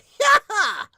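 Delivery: loud high yelped laugh; character's signature cry. Shown as a demonstration. Host demonstrating anime characters' trademark moments, loud exuberant yell. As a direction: burst out a big cartoonish "hyah-ha". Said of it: "I know the".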